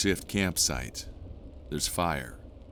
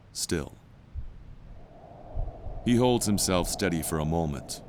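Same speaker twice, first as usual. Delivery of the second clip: usual speaking voice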